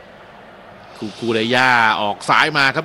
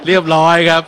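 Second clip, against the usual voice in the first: louder, laughing through เรียบร้อยครับ; 3 semitones higher, 7 dB louder than usual. Real, not acted. Commentator jokes about the offside player, laughing. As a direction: chuckle out เรียบร้อยครับ as a joke. Repeats ruling while laughing.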